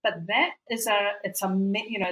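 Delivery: ordinary talk, nothing marked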